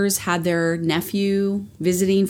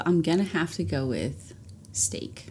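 hushed, secretive answer; whispering; 10 dB quieter than usual. Real, not acted. Whispered guess in a quiz.

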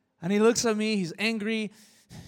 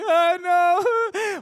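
tearful cry; 12 semitones higher, 10 dB louder than usual. Retold or imitated. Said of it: "We're both crying".

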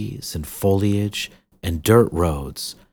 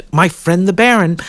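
quick, higher self-correction; hasty correction; 12 semitones higher, 8 dB louder than usual. Acted. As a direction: fix the slip quickly and brightly. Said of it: "quickly corrected herself".

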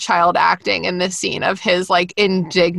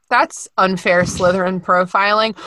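usual speaking voice

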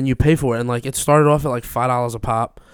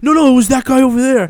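high, loud mock-innocent voice pointing the blame; hammed-up fake innocence; innocent denial; 13 semitones higher, 10 dB louder than usual. Prankster blaming the stranger for the smack, loud high mock innocence.

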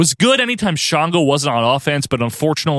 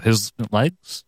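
low mock Undertaker; 6 semitones lower, 4 dB quieter than usual.

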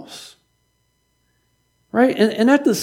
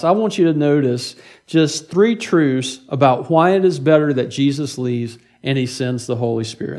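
ordinary delivery

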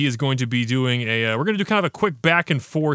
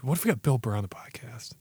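whisper; 2 semitones lower, 8 dB quieter than usual. Real, not acted. Scheming to book a guest.

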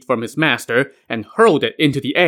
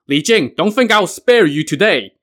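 higher-pitched, restrained narrated shout; shouting threat; 5 semitones higher, 4 dB louder than usual. A narrator's reading. Ne Zha threatening his fleeing father, mildly shouted. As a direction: call out a threat in a mild shout. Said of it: "Ne Zha shouted".

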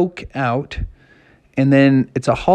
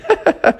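put-on girlish giggle. Sister laughs in story.

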